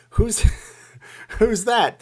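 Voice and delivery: high, loud, laughing question; movie scene voice. Acted. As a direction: squeal the question at the roadie. Acting out Wayne's World 2 scene.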